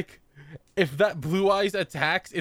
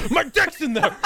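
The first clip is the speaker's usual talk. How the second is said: panicked yell; 12 semitones higher, 4 dB louder than usual. Retold or imitated. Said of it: "he's just like".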